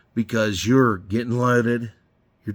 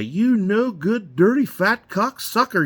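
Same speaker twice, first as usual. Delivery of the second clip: mock insult; 10 semitones higher, 4 dB louder than usual. Coworker firing back over the radio, higher hammed-up insult. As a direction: fire back at a buddy playfully. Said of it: "He'd get on there and say".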